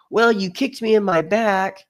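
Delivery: put-on high, slow, indignant kid's complaint; imitating upset kid. Voicing the hurt kid confronting the group.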